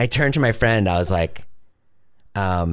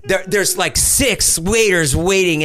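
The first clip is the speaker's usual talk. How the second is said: ordinary talk, nothing marked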